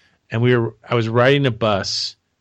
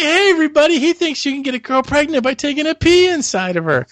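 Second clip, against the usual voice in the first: calling out; 13 semitones higher than usual. Retold or imitated. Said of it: "I was like".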